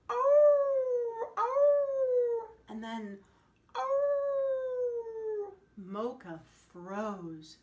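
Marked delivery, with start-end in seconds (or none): 0.1-2.5 high, loud, long howl; wolves howling. A narrator's reading. Wolves howling back at Mocha from the mountain, loud high howl. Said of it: "something howled back". 3.7-5.5 high, loud, long howl; wolves howling. A narrator's reading. Wolves howling back at Mocha from the mountain, loud high howl. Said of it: "something howled back".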